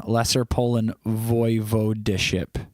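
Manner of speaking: usual speaking voice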